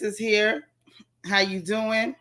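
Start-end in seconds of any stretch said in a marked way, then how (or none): none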